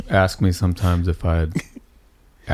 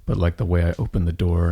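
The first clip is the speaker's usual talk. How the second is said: ordinary talk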